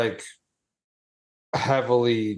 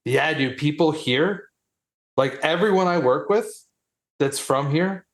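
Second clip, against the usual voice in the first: usual speaking voice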